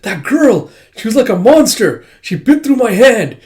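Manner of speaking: high, loud shaken voice; panicked telling. Retold or imitated. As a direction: stammer out the horror. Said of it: "He's like".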